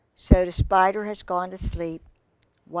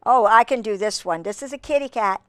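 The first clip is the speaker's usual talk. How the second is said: usual speaking voice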